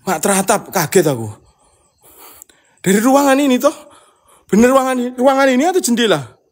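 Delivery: high, loud, quick and nervous; high loud rattled questions about the room or window; agitated high questions. Real, not acted. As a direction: demand to know where the noise came from. Startled, asking where the sound came from.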